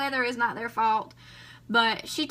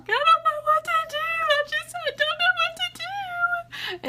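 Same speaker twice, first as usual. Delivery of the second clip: high, slow sobbing wail; imitating her own crying on the phone; slower than usual. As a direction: wail it out through tears, very high. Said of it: "sitting there crying".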